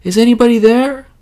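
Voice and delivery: high, loud, unsure call; high child calling into the forest. Acted. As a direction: call out to anyone nearby. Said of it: "called the child".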